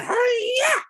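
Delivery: very high karate-chop shriek; Miss Piggy. Acted. Imagining her starting the train fight, Miss Piggy's shriek. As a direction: let out a piercing diva battle cry. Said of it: "recast it as Miss Piggy".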